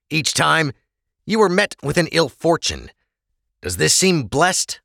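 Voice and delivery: stern officer voice. Acted. Officer scolding the prisoner, lightly stern. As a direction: lay down the law to him.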